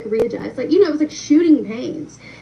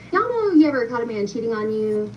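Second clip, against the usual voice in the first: usual speaking voice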